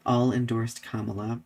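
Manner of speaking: normal talking voice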